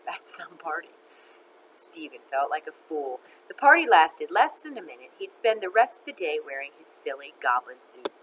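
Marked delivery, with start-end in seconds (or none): none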